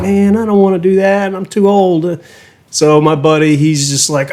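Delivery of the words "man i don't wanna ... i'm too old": weary protest. Retold or imitated. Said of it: "I'm like".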